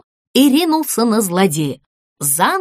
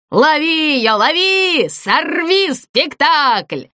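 higher, drawn-out ghostly command; lightly voiced icy villain, stretching the words; 5 semitones higher, slower than usual. A narrator's reading. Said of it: "донёсся ледяной голос". (ледяным голосом, протяжно)

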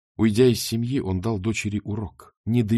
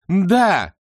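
triumphant gloat; 11 semitones higher, 6 dB louder, slower than usual. Acted.

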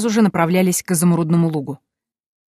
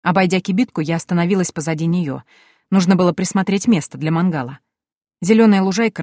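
usual speaking voice

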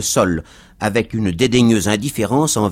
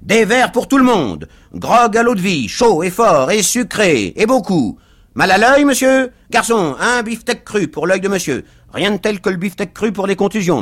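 high, loud volley of orders; loud, voluble stranger; 11 semitones higher, 6 dB louder than usual. A narrator's reading. Stranger orders drinks and steak for everyone, loudly. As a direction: call out the order in emphatic bursts.

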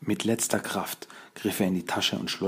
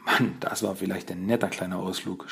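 ordinary delivery

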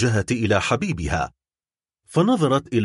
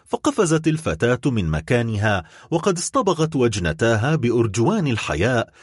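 usual speaking voice

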